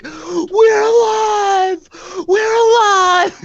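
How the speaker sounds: high, slow, loud screams of relief; joyful screaming. Acted. Survivor screams with joy beside the paladin, high and slow. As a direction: scream it out, overjoyed to be alive.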